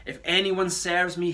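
ordinary delivery

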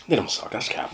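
whispered aside. Real, not acted. Quick aside taking it back.

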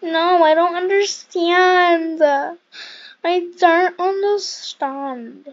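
slow, loud, drawn-out whine; frustrated whine. Real, not acted. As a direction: stretch it out, fed up. Frustrated at puzzle.

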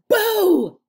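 loud, high monster boo; scary boo. A narrator's reading. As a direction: jump out and shout boo. Monster scare in dark.

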